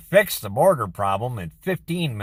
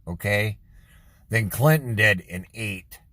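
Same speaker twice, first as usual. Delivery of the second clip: ordinary talk, nothing marked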